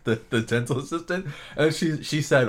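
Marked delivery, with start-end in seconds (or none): none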